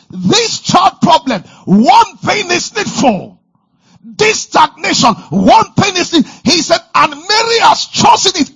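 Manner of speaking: loud, high, rousing preacher voice; preaching at full force. Acted. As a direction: drive it home to the congregation.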